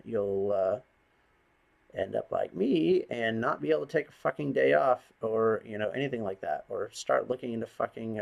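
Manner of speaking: normal talking voice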